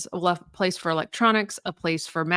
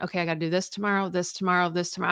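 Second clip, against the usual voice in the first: rattled off fast and a little softer; fast inner to-do list; 4 dB quieter, faster than usual. Retold or imitated. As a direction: race through the list in your head. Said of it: "in my mind, I'm going".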